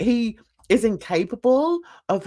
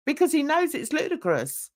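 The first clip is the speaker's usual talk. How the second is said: ordinary delivery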